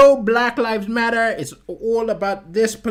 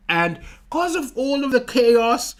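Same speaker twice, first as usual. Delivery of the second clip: ordinary talk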